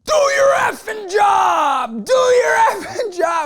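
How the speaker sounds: high, loud furious scream with a crying edge; screaming homeless man. Retold or imitated. Homeless man screaming at a driver through the window, furious shout. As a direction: yell it at the driver like you've lost it. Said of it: "he's screaming at me".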